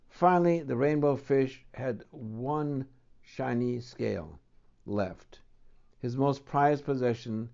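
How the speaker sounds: lively storybook read-aloud; reading to children. Acted. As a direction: tell it to a child at bedtime. Reads picture book aloud.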